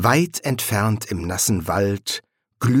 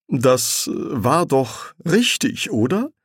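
slow, higher, hesitant question; timid; 6 semitones higher, slower than usual. A narrator's reading. Angel seeking reassurance from the serpent, timid. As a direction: ask timidly, lifting high on the tag question. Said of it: "fragte er zaghaft".

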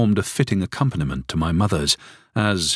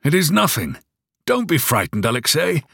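calling out; 8 semitones higher than usual. A narrator's reading.

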